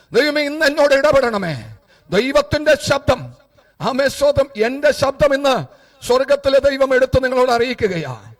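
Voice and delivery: loud, high-pitched proclamation in short bursts; full-force preaching. Acted. Preacher promising the congregation that God opens the way, full-force preaching. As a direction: proclaim it with all your power.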